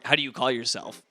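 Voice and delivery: ordinary delivery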